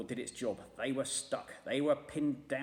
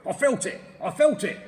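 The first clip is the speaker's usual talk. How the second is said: louder, higher indignant shout; 10 semitones higher, 12 dB louder than usual. A narrator's reading. Mister Twit shouting about the droppings. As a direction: shout back in disgust.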